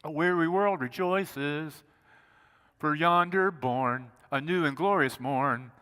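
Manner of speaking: slow, lilting recital of a carol verse; a slowed, reverent reading of O Holy Night; recited hymn lyric. Shown as a demonstration. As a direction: speak the hymn words slowly like a prayer. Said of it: "You know the verse that says".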